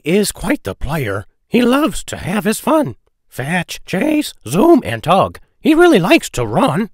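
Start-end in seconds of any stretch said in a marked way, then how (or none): none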